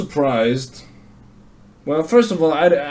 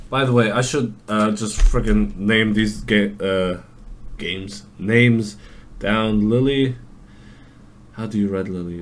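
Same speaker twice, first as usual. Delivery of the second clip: ordinary talk, nothing marked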